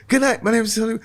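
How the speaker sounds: high, called-out goodnight; abrupt stage sign-off. Retold or imitated. Nervous new comic signing off at the light, high call-out. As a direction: call it out to the room, pitched well up.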